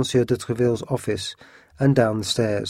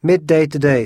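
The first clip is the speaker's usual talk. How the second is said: prompt; 4 semitones higher, 5 dB louder than usual. A narrator's reading. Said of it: "said Athos promptly".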